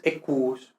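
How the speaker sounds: "equus" with a lengthened double U; E-kuus for "equus"; long U. Shown as a demonstration. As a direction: hold the U in "equus". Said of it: "como una U muy larga".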